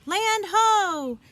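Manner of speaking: high, loud lookout shout; full loud pirate call, pitched high; yelled land ho. A narrator's reading. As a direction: bellow it from the top of the mast. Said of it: "When Nick yelled".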